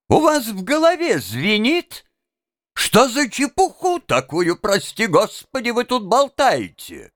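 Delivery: high-pitched, scandalised scolding; indignant exclamation. A narrator's reading. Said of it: "воскликнул конректор Паульман". (возмущённо восклицая)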